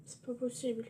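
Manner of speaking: ordinary talk, nothing marked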